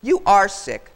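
loud, punched retort; loud indignant goose squawk. A narrator's reading. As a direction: squawk it back in disgust. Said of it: "Matilda squawked".

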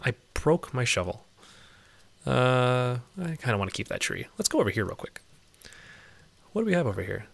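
ordinary delivery

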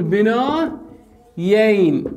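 بنايين very slow, in long held parts; drawn-out demonstration. Shown as a demonstration. Teacher offers students the other form, slow drawn-out demonstration. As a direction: stretch both halves of بنايين.

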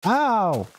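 sharp high ow; high yelp. Real, not acted. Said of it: "I don't know why I'm saying ow like that".

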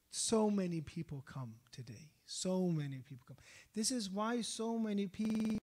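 rousing sermon climax; fiery preaching. Acted. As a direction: preach it out to the congregation.

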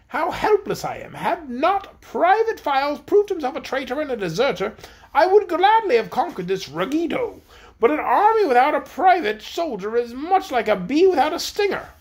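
high-pitched proud queen voice; voiced high-pitched queen, complaining; haughty queen. A narrator's reading. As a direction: play the queen, lofty and put out. Queen Anne's lament.

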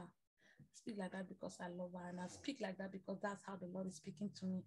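low, very soft and settled; quiet, sincere sign-off. Real, not acted. Pastor explaining himself and blessing listeners, quiet sincerity.

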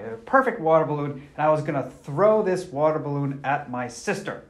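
ordinary talk

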